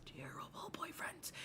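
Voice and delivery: hushed, breathy repetition; whispered aside. Real, not acted. Mocking friends' bad boyfriends in a low whisper. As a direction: drop to a whisper for the punchline.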